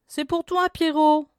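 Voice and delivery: high, loud call to the dog; women calling down to the dog. A narrator's reading. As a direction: call down the well.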